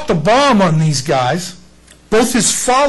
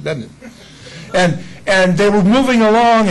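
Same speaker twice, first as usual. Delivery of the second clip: normal talking voice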